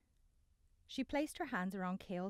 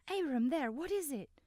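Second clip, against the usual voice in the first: alarmed; 8 semitones higher, 6 dB louder than usual. Acted. Ayanna spots the creature.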